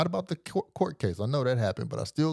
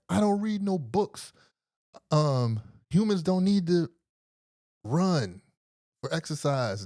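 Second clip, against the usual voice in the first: much higher, slow sermon-like delivery; mock preachy doctor; 7 semitones higher, slower than usual. Narrator imitates the doctor dismissing books and running, high and preachy. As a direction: preach it like a playful guru.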